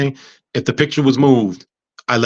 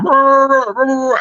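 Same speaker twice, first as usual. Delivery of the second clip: straining grunts through tubes; 14 semitones higher, slower than usual. Retold or imitated. Patient with throat tubes protesting to the doctors, high strangled grunts. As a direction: try to speak with a tube down your throat. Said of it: "I can't talk".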